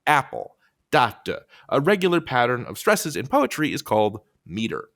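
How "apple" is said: APPLE with a strong first syllable, weak second; stress on first syllable. Shown as a demonstration. Teacher demonstrating stresses, first syllable of APPLE stressed. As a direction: lean on the start of APPLE. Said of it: "the first syllable is stressed".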